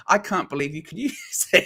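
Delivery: can't stop laughing while retelling it. Retold or imitated. Host retelling his reaction to co-host's software, laughing. Quoting himself, laughing at friend.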